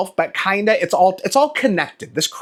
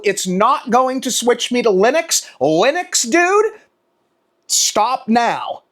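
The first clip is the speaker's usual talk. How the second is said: annoyed rant; 5 semitones higher, slower than usual. Real, not acted. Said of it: "I'm annoyed, I'm voicing my concerns".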